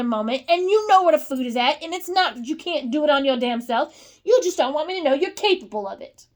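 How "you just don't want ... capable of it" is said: usual speaking voice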